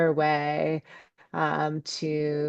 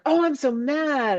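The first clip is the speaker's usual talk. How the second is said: hammed-up exasperation; acted mad outburst; 10 semitones higher than usual. Speaker voicing own anger to let it out, exasperated. Acts out fully owning being mad.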